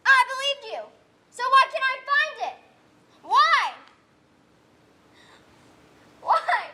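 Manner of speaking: put-on a grieving girl demanding answers from the sky; anguished plea. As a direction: cry out why in despair.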